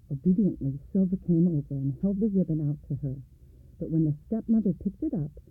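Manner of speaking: ordinary delivery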